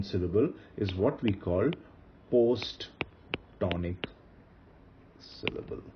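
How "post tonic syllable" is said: very slow, word by word; slow term introduction. Shown as a demonstration. Teacher introducing a new term to students, slow and spaced out. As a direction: spell the term out slowly, pausing before the last word.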